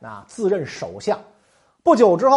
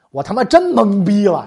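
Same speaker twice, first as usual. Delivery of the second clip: loud exasperation; 4 semitones higher, 10 dB louder than usual. Retold or imitated. Secretary utterly confused.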